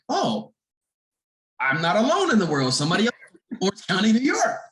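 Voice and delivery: high, rushed, rising disbelief; excited realization. Retold or imitated. Her inner thought on finding a fellow local, excited. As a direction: let the thrill climb to York.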